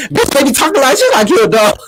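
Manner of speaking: high, hammed-up outburst; squealed mock outrage; Portia imitation. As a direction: mimic an indignant outburst for laughs. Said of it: "you was like".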